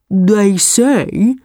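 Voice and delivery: unhurried buffalo; slow buffalo voice. A narrator's reading. Buffalo explains to tiger, slowly. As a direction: let it come out slowly. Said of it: "said the buffalo slowly".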